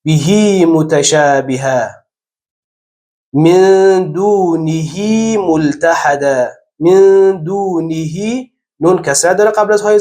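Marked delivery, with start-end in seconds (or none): 0.1-1.9 «بهی متشابهه» slowly, the هی in بهی drawn long as hee; stretched hi. Shown as a demonstration. Teacher demonstrates the stretched pronoun hi, slow. 3.3-8.5 «من دونه ملتحدا» very slowly, the ه in دونه drawn long as hee; stretched hi. Shown as a demonstration. Teacher chants the example with ishba, very slow.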